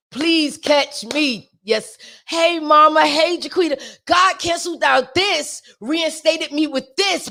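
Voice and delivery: ordinary talk, nothing marked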